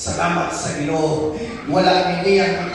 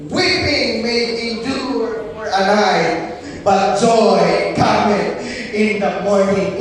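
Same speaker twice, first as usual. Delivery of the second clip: slow, louder toward joy; slow emphatic scripture; 6 dB louder, slower than usual. Real, not acted. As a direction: read each word with weight. (hinay ug kusog nga pagbasa sa kasulatan)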